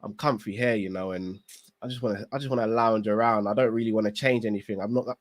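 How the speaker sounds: lightly voiced lounging husband, low; mock the lazy guy who won't try, in a low voice; lazy comfy husband. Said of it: "I turn around and I go".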